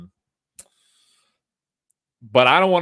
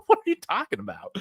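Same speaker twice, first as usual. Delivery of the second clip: incredulous. Acted. Incredulous reply to critics.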